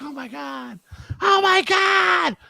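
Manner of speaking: very high imitation of shock, swelling loud; squealed mock disbelief; mock freak-out. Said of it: "And then they freaked out".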